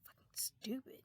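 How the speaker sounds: whispered insult; whispered like a muttered aside; retold whisper. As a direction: hiss it under the breath. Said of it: "his brother is, like, saying".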